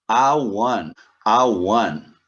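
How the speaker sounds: "one" said loud as "wun", W glide; model pronunciation. Shown as a demonstration. Teacher models "a one" loudly for students, W glide. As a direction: start "one" with a W. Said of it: "como se eu tivesse um W".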